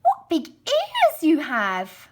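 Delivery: high, loud and slow; wide-eyed little girl. Acted. Girl marveling at the wolf in bed, high and slow. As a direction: gasp out the wonder of a small child.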